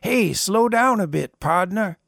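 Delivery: put-on old man worried about John guzzling soda; concerned old man. A narrator's reading. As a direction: caution him kindly. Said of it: "with concern in his voice".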